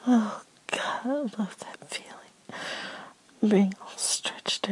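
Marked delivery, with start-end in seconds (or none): none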